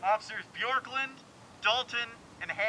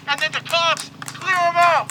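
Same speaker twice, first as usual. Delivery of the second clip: angry shout; 7 semitones higher, 11 dB louder than usual. Real, not acted. Angry rant about police.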